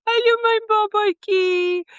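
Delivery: very high sweet mommy voice. Retold or imitated. Playing mommy to the little girl, high and sweet. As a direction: coo it as a pretend mother. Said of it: "And I'm like".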